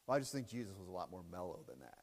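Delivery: a soft, quick parody of the doubter; mocking mellow skeptic. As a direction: sneer it out as the doubter, quick and low. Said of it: "That's what he told me".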